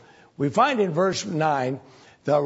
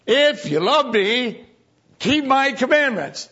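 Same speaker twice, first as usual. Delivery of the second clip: booming quote of God; 9 semitones higher, 5 dB louder than usual. Acted. Preacher quoting God's words.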